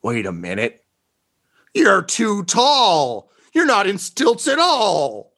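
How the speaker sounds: high, slow drawn-out shock; stunned realization. Acted. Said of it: "And he's like".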